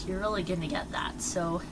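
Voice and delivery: ordinary talk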